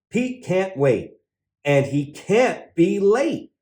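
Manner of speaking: usual speaking voice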